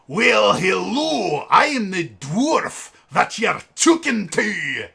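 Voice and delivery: loud, slow mock-Scottish dwarf. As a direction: boom it out in a hammed-up Scottish accent. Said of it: "incredibly stereotypical Scottish accent".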